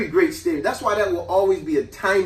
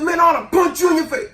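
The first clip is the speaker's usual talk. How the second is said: put-on higher, louder kid shout; angry threat; 11 semitones higher, 4 dB louder than usual. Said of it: "I said".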